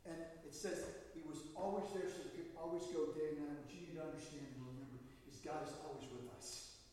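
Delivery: ordinary delivery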